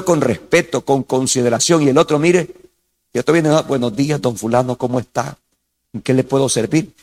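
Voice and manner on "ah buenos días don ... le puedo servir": gentle greeting. Shown as a demonstration. Showing a gentle, calm greeting.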